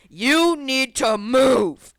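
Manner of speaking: loud, very slow mock demand; hammed-up blockhead anger; imitated angry kid. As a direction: drag out each word with exaggerated menace. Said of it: "angry Minecraft Steve looking motherfucker".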